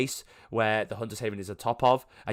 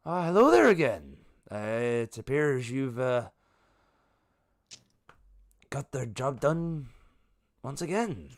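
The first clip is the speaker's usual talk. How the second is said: a touch of a greeting NPC; 2 semitones higher than usual. Acted.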